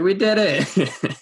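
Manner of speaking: high, drawn-out victory cry; retold cheer. High-fiving friends after getting down, cheering. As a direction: re-enact a triumphant cheer, slow and high.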